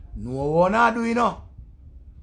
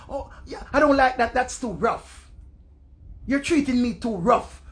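higher, proper-English complaint; mock prim woman; 3 semitones higher than usual. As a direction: play a delicate lover protesting. Mimics shy woman objecting.

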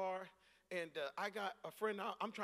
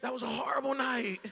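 loud, high, shouting no to the memory; emphatic refusal; 5 semitones higher, 13 dB louder than usual. Retold or imitated. Preacher refusing friends' nostalgia, loud and shouting. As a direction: yell it like you never want to go back. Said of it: "And I'm like".

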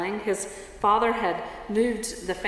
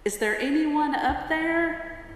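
mild frightened call upward, higher pitched; 7 semitones higher than usual. Acted. Tourist hanging off a cliff calls toward heaven, calling out. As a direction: call toward heaven for help, mock terror. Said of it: "she called out toward heaven".

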